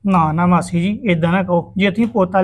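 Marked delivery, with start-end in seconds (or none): none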